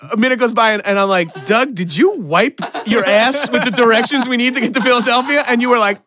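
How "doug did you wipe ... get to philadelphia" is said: high, loud incredulous question; disbelieving accusation, voice up; retold mock outrage. Accusing Doug, laughing.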